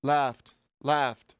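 flat bored monotone. Retold or imitated. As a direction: drone each word, no interest. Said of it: "Just monotone the whole time".

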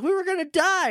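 mock panicked whine. Rangers complain to Doggy.

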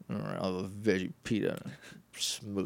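low, soft, deflated mumble; hammed-up disappointment, trailing away quietly; mock reluctant giving-in. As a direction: imitate a kid grudgingly changing the order. Voices Danny giving in reluctantly.

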